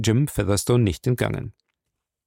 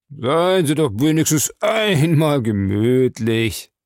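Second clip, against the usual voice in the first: slow, higher, grinning coaxing; 4 semitones higher, 3 dB louder, slower than usual. A narrator's reading. Suspect urging the captain to relax, grinning. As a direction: draw it out cajolingly with a grin. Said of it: "grinste Smith".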